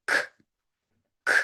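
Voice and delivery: k as a bare puff of air; unvoiced k with the uh skipped completely; no added vowel. Shown as a demonstration. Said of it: "we can skip that uh completely".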